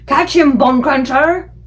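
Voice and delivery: loud high giant shout. A narrator's reading. Flesh Lump Eater shouting while tossing the BFG. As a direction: hurl the call across the field.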